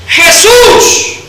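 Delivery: slow, high, loud proclamation; proclaiming cry. Acted. Beggar proclaiming Jesus as deliverer, loud slow cry. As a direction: proclaim him as saviour. Blind man proclaiming messiah.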